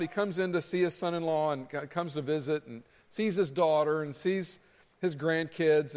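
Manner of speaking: ordinary talk, nothing marked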